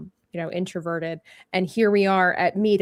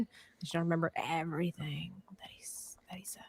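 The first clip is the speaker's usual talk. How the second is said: whispered confession; 3 semitones lower, 13 dB quieter, faster than usual. Retold or imitated. Admitting they forgot what the fan said, whispered. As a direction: voice sinking into a whisper. Admitting a lapse, voice drops away.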